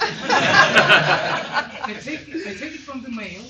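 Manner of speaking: loud and drawn out, cracking up mid-word; can't hold the laugh in. Real, not acted. Admitting to the publisher she throws magazines away, laughing. Laughing while telling about junk mail.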